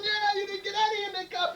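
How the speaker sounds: high, gleeful crowing; jubilant bartender. Acted. Bartender celebrating won bet, high and jubilant. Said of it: "jumping up and down going".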